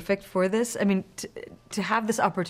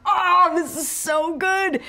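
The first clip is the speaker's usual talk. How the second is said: thrilled; 10 semitones higher, 5 dB louder, much slower than usual. Retold or imitated. Thrilled watching Daisy's scene.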